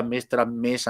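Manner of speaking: usual speaking voice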